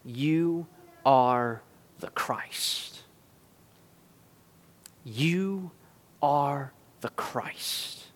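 slow, near-whispered awe; quiet, weighty revelation with long pauses; hushed confession. Acted. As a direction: breathe each word out softly and slowly. Solemn acting of Peter's confession.

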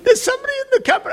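put-on high, frightened child's voice; little girl, scared, high-pitched. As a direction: play the small daughter insisting in fear. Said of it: "I said, "What do you mean?".

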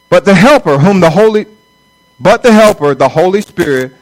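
scripture reading. Acted. Reading scripture aloud in sermon.

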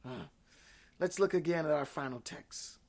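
normal talking voice

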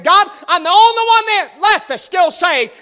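high, loud self-pitying whine; whiny man child. Retold or imitated. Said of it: "whining like a little baby".

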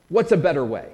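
ordinary delivery

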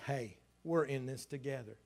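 ordinary talk

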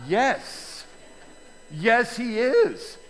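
normal talking voice

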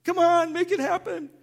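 high, loud, desperate self-push; straining to force calm; strained forcing. Retold or imitated. Pastor mocking himself forcing focus, high and strained. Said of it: "and like".

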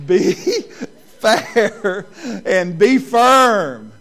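laughing through the words, slow and loud; can barely get it out for laughing. Real, not acted. Pastor cracking up at his own story while repeating the rule.

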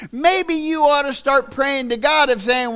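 normal talking voice

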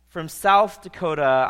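usual speaking voice

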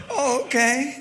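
high, startled oh okay; husband caught out by his wife, mock surprised; mock surprise. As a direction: play it as a sheepish surprised yes. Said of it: "And I was like".

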